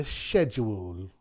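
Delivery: mock British "schedule" said as "shedule"; playful posh British "the schedule"; British accent. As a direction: say "schedule" with a soft sh, British style. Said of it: "as the British would say".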